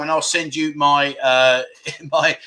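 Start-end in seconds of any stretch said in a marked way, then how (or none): 1.8-2.4 laughing. Real, not acted. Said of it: "I'm laughing 'cause I'm gonna talk about this".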